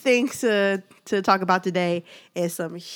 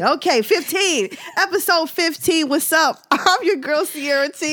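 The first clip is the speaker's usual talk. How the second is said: higher, peppy host greeting; bright podcast intro; 8 semitones higher than usual. Acted. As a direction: announce the show with energy. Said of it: "let's try it again".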